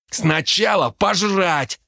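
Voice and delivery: high, drawn-out, impatient bark; barked order. A narrator's reading. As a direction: bark it at a friend, stretching the words. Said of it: "рявкнул Дарт". (рявкнуть нетерпеливо, протяжно)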